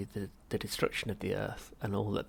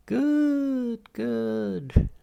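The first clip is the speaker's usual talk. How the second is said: much higher, louder beaming delight; beaming Halfrunt; 14 semitones higher, 10 dB louder than usual. Acted. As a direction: beam with pleasure. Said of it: "beamed Halfrunt".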